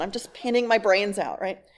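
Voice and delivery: usual speaking voice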